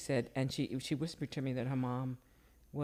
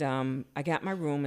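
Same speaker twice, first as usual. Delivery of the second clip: ordinary talk, nothing marked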